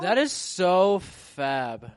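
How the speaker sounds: slow, high, camp "fab"; campy fabulous. Acted. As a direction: drawl it out campily. Mocking "fabulous.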